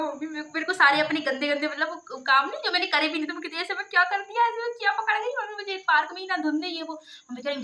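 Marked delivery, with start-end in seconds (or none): 3.6-5.3 indignant protest. Retold or imitated. Retold protest to scolding mother.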